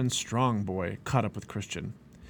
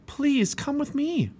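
high, drawn-out plea; pleading; slower than usual. A narrator's reading. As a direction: beg slowly in a high voice. Begging family to come.

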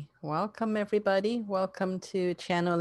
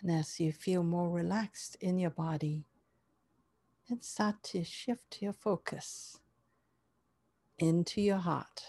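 guided meditation; 3 dB quieter than usual. Acted.

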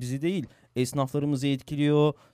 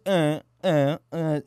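mock stumbling, filler after filler; halting hesitation; 3 dB louder, much slower than usual. Co-host mimics the actor's halting statement, hesitant imitation. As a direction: imitate someone groping for words with repeated "uh". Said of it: "şeklinde giden bir açıklamaydı".

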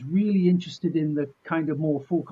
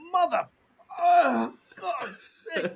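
high strained curse, fading out; winded after a liver shot; pained groan; 8 semitones higher, slower than usual. Retold or imitated. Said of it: "I'm bent down going like".

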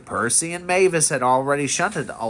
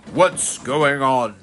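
mild storybook shout, drawn out; slow, understated cross demand; 3 dB louder, slower than usual. A narrator's reading. Railway boss demanding to know what happened, mildly cross. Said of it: "he shouted".

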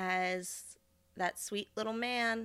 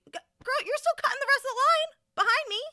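much higher, louder sassy call-out; exasperated retort; 6 dB louder, faster than usual. Real, not acted. Narrator calling out the woman cutting the line, high and sassy. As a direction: call her out in disbelief.

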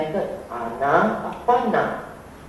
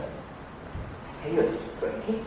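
confiding whisper; 11 dB quieter than usual. Real, not acted. Teacher letting students in on a secret, whispered. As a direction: lean in and share a secret.

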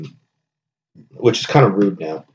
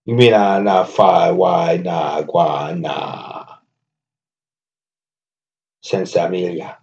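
1 Jamaican patois rant; slower than usual. Pretend. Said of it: "[name] doing accents and then losing".

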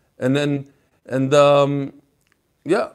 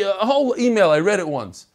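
ordinary talk, nothing marked

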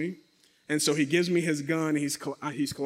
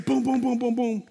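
high, rapid string of booms; imitated running footsteps; 5 semitones higher than usual. Storyteller running up the stairs in fear, imitated thuds. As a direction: pound out booms like feet on stairs.

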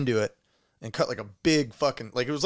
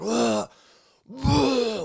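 high strained gagging groans; retold choking noises; 11 semitones higher, 4 dB louder than usual. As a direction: reenact the choking retch. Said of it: "making these crazy fucking noises".

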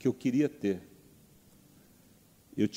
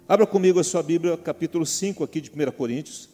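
loud, rising pulpit call; rally the congregation to open their Bibles; preaching; 4 semitones higher, 6 dB louder than usual. Acted. Preacher calling the church to read Corinthians, full force.

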